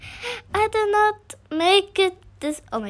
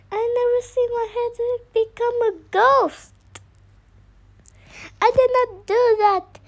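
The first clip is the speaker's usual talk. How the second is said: higher, louder indignant denial; loud protest; 7 semitones higher, 5 dB louder, faster than usual. Real, not acted. Gamer denying he caused the glitch, loud protest. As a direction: insist loudly it wasn't you.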